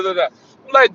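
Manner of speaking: ordinary talk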